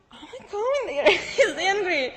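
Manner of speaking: real laughter breaking through; laughing. Real, not acted. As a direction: giggle it out, amused at yourself. Laughing while retelling visa scare.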